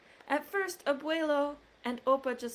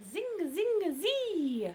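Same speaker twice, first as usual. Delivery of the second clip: fiddle imitation; 8 semitones higher than usual. Fiddle sound in book.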